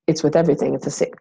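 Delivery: ordinary talk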